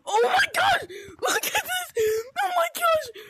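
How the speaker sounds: loud, ecstatic fan freak-out; real overwhelmed, excited yelling; screaming with excitement. Real, not acted. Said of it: "I'm gonna stop screaming".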